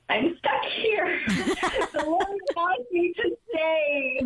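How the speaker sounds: high, slow, half laughing half crying; laughing through tears. Retold or imitated. Telling her guardian angel she must stay, laughing through tears. Weeping after the retreat revelation.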